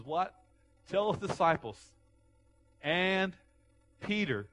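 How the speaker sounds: high, loud divine command; loud commanding voice of God; the Lord calling out. Acted. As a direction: call it out like the voice of the Lord.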